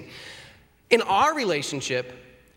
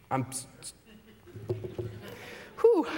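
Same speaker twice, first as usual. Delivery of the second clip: quiet, halting struggle to get the word sorry out; stammer, trailing off, unable to finish; choking on the apology; 3 semitones lower, 6 dB quieter than usual. Acted. Husband to wife, can't make himself say sorry, quiet stammering. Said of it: "the words that are so difficult to come out".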